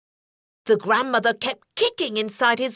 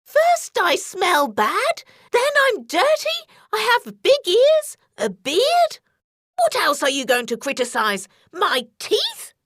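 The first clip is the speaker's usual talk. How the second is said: higher, louder rising complaint; wolf ranting at the girl, higher and louder; indignant rant; 8 semitones higher, 3 dB louder than usual. A narrator's reading. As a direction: rant and build up offended.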